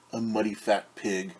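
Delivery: ordinary delivery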